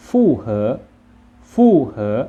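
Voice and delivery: ฟู่เหอ as Chinese, loud with a steep fall; Chinese pronunciation. Shown as a demonstration. Teacher models the word for suitable, Chinese pronunciation of ฟู่เหอ. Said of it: "ภาษาจีนอ่านว่า".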